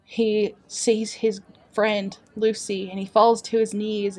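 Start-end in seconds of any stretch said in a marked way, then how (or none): none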